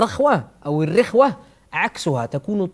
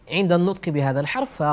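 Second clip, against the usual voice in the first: ordinary talk, nothing marked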